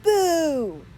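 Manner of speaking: loud high boo; ghost's boo. Acted. Ghost spooking the boys, loud high boo. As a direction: jump out and shout boo. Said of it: "yelled a ghost".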